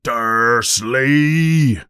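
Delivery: long, drawn-out angry yell; explosive bellow of a name. A narrator's reading. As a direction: stretch the name into a roar. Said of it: "he yells".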